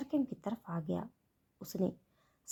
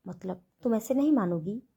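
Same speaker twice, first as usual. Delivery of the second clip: ordinary delivery, nothing marked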